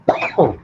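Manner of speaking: a short throaty cough. Real, not acted. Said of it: "Excuse me while I choke".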